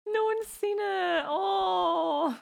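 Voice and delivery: high, drawn-out pretend wailing; mock wail. Friend mourning an unseen musical, mock wailing. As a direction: lament it like a hammed-up tragedy. Mock lament that nobody saw it.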